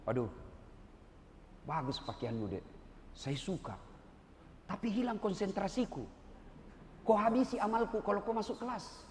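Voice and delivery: gentle scolding. Retold or imitated. Ustadz gently sends a student outside, lightly soft. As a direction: ease the student out with kindness. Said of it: "Tapi saya tidak kasar ngomong".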